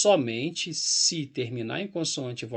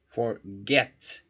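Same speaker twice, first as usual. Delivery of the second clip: Forget split, landing on GET; stress on the final syllable; 3 semitones lower than usual. Shown as a demonstration. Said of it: "A sílaba tônica é a final".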